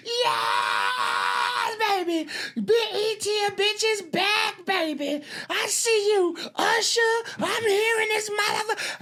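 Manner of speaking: very high, shrieking celebrity yell; screaming and yelling. Retold or imitated. [name] screams to the crowd and [name], very high and excited. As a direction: scream it like a host hyped on stage. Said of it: "she's screaming and yelling".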